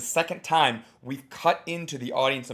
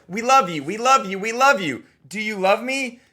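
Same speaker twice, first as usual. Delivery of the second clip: higher, louder sing-song crowd; mock crowd; 7 semitones higher, 5 dB louder than usual. Coach voicing the audience, light mock chant. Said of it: "the audience was like".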